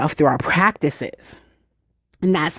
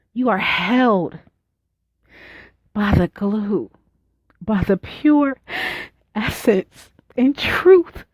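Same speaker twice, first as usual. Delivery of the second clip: meditative reflection. Acted. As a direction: ask it gently, with long pauses.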